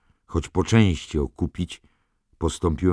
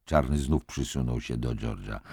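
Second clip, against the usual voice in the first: ordinary delivery, nothing marked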